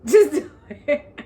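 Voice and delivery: emphatic and amused, real laugh breaking in; laughing outburst. Real, not acted. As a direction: blurt it out through a laugh. Repeats it louder, breaking into laughter.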